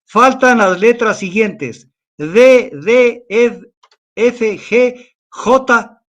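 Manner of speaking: put-on slow, measured reading of an old text; reading aloud from a book. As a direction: read the old chronicle to the audience. (lectura pausada de un libro antiguo)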